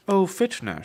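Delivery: high, loud, mocking society lady; haughty lady. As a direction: sing it out like a scheming noblewoman. Female noble character greeting.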